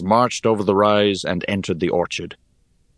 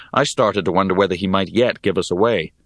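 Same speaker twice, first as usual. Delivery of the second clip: ordinary delivery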